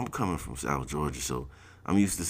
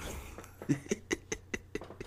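laughing; 12 semitones higher, 10 dB quieter than usual. Retold or imitated. Laughing at the joke.

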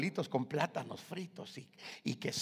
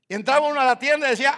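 fast excited storytelling; 11 semitones higher, 17 dB louder, much faster than usual. Acted. Fast lead-in to the shop story.

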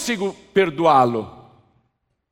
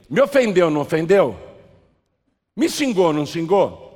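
louder, insistent demand for agreement; pressing question; 5 dB louder than usual. Acted. Preacher repeating the question about the insult, pressing. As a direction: press them hard for an answer.

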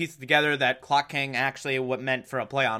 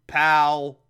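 usual speaking voice

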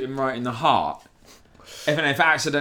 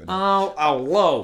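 put-on a light New York accent, slightly higher; a hint of a New York mobster accent; 3 semitones higher, much slower than usual. Friend says it sounds like New York, light New York accent. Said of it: "sounds like New York".

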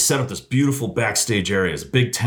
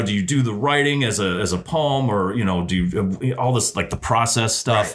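ordinary talk

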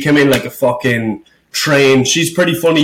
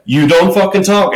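stern host; 6 semitones higher than usual. Retold or imitated. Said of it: "She was, like, stern".

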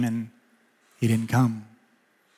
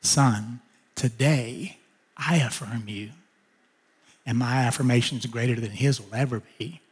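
softer, gentle voice of God; tender fatherly voice; 3 semitones lower, 5 dB quieter than usual. Retold or imitated. God comforting the grieving son in his car, soft and tender. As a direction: speak it gently like a loving father.

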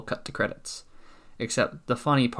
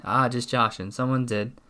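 ordinary delivery

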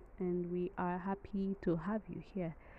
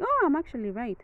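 higher, louder burst of surprise; pleasantly surprised; 6 semitones higher, 6 dB louder than usual. Real, not acted. Podcaster finding Google confirms her own guess, pleasantly surprised. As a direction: exclaim it on finding you guessed correctly.

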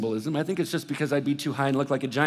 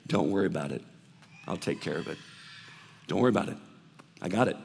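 reassuring voice of God; 5 semitones lower, much faster than usual. Acted. Pastor voicing God comforting the listener, low and gentle. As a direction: settle their fears like a calm father.

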